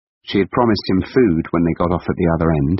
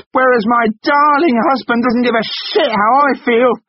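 very high, loud bitter sarcasm; mock him at full volume; sarcastic shout; 5 dB louder than usual. Wife sarcastic scream.

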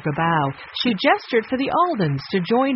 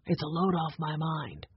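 soft, low whisper; 3 semitones lower, 10 dB quieter than usual. A narrator's reading. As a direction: hush it to keep it private. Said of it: "Ray whispered".

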